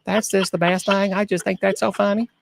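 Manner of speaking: a light impression of a delighted old lady; imitating Miss Wilma. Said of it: "She's like".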